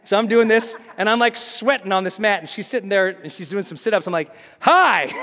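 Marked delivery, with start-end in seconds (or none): 4.6-5.1 loud, high, bright-eyed "Hi"; eager greeting. Retold or imitated. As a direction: burst out with an overeager hello. Overeager greeting to girl.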